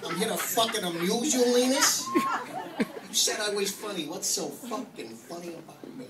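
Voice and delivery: a hammed-up Joe Pesci threatening his buddy; Joe Pesci Goodfellas. Comic doing Joe Pesci at his friend Linus, mock menace. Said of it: "So I did a little Joe Pesci, uh, Goodfellas".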